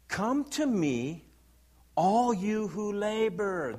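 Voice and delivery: high, very drawn-out reading; declaimed scripture. Acted. Pastor reads Jesus' call to congregation, slow high declamation. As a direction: stretch each word out to the congregation.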